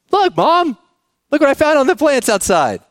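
put-on high eager child voice; excited kid. Kid showing mom taped fruit, high excited child voice. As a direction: squeal it up like a proud little kid.

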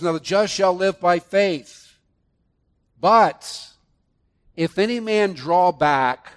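loud, proclaiming the verse; forceful scripture reading. Acted. Preacher reading Hebrews aloud to the congregation, forceful. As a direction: declare the verse with weight.

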